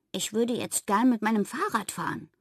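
plain reading voice

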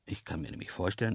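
usual speaking voice

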